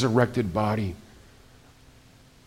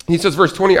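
ordinary delivery

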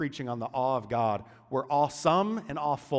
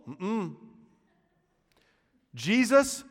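usual speaking voice

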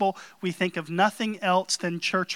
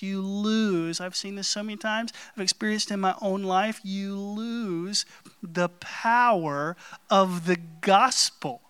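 normal talking voice

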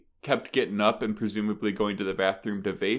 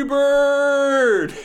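high, loud drawn-out holler; heckler's yell; 9 dB louder than usual. Retold or imitated. Somebody in the audience heckling, loud drawn-out yell. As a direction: yell it across a theater. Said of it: "hilariously yells".

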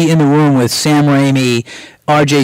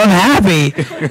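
retold delight; 4 semitones higher, much slower than usual. Retold delight at being fired.

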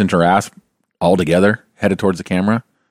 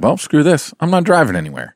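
higher, fed-up mock grumble; fed-up driver; 7 semitones higher than usual. Brother imitates fed-up drivers giving up, pitched higher. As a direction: voice the fed-up public quitting. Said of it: "people were just like".

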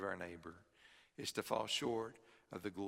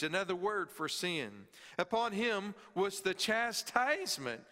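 higher, louder preaching voice, pressing each phrase; preaching at full force; 7 semitones higher, 3 dB louder than usual. Acted. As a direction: preach it out to the congregation with rising force.

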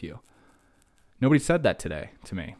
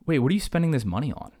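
indignant demand; 6 semitones higher, 5 dB louder, faster than usual. Retold or imitated. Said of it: "I'm like".

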